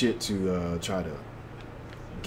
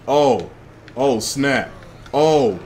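startled oh; 4 semitones higher, 6 dB louder, much slower than usual. Real, not acted. Surprised by explosion.

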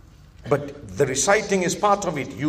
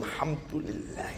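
whispered exaggerated strained recitation; hammed-up straining worshipper; mock overzealous reciter; 2 semitones lower, 13 dB quieter than usual. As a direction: imitate someone straining over every sound. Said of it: "'Cause I've seen some people".

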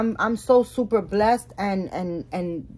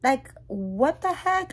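high-pitched, slowed disbelief; shocked what; 4 semitones higher, much slower than usual. Retold or imitated. Retelling her reaction to the seller's claim, high and slowed. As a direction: pull up the pitch, then stretch out the heck. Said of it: "I'm like".

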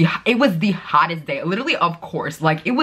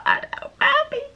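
much higher, slow, breathy-sweet fan greeting; hammed-up very high gushing hello; starstruck fangirl; slower than usual. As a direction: overdo the dazzled fan meeting him. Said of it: "I was like".

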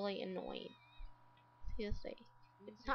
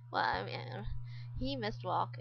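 normal talking voice

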